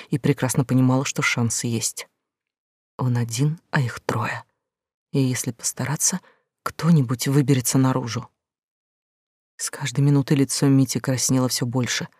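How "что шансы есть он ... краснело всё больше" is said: ordinary delivery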